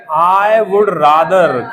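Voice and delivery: loud, drawn-out dictation; slow loud prompt. Shown as a demonstration. Teacher prompts students to speak along, slow and loud. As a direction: lead the class word by word, loudly. Teacher leads class in saying sentence.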